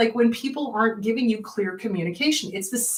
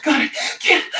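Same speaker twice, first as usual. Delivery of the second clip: loud, gasping hyperventilation; hammed-up breathless panic; mock panic attack; 6 semitones higher, 6 dB louder, faster than usual. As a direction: act out gasping for air between words. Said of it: "go into a panic attack".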